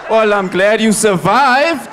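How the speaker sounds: loud, slow, laughing sarcasm; sarcastic comeback. Retold or imitated. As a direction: drag it out dripping with sarcasm. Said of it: "sarcastic reply".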